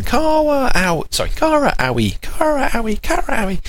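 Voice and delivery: put-on very high childlike name calls; the two girls greeting each other in baby voices; toddlers calling each other. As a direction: squeal the names like excited little sisters. Sisters greeting in the morning.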